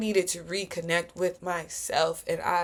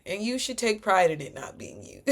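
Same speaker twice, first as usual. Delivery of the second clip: usual speaking voice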